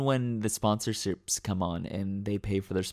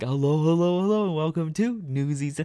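higher, louder, hammed-up hello; over-the-top fan version; 7 semitones higher, 3 dB louder than usual. Fan at an event belting the host's catchphrase, hammed up. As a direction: belt the catchphrase like an excited fan. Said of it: "go as hard as you want".

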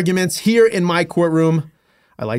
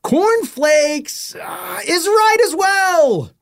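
excited host call; 12 semitones higher, much slower than usual. Acted. Announcing a correct answer.

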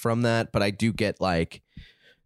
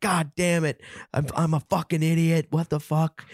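higher-pitched, exasperated self-scolding; mad at oneself; 6 semitones higher than usual. Retold or imitated. Friend retelling being late through own fault, mad at oneself. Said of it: "I was so mad at myself".

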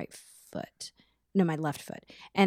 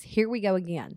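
normal talking voice

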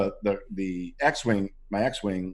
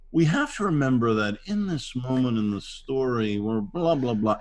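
droning director; 4 semitones higher than usual. Acted. Actor imitating a long-winded director putting the cast to sleep, droning. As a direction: lecture on and on, stretching the words. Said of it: "you're literally putting the actor to sleep".

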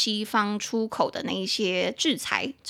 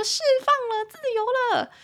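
elated high-pitched "you're free"; liberated release; 14 semitones higher than usual. Acted. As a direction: let it soar up high, held long like a release. Mimes lid lifted, being set free.

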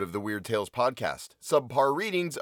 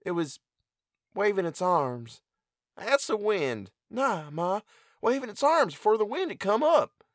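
higher rustic country voices for both figures; voiced farm mother and son; 10 semitones higher than usual. Mother questioning her son by the cornfield, higher rustic voices. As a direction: play the mother and her sullen boy.

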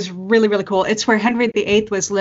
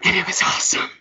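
breathy whisper; faster than usual. Real, not acted. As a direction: hush it like a fond secret. Hushed fond memory.

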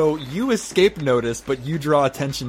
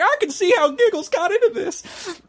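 much higher, smug little voice; high character voice. Acted. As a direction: pitch way up for the bard. GM voices Gumbus.

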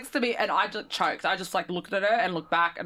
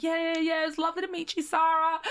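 polite goodbye; 6 semitones higher than usual. Retold or imitated. Star's polite goodbye, retold.

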